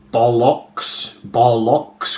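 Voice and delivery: "bollocks" with a full O in both syllables; "bollocks" sounded out literally, second syllable rhyming with lock; strictly phonetic. Shown as a demonstration. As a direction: say "bollocks" as spelt, BOL-LOCKS, both O's clear. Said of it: "say this word strictly phonetically".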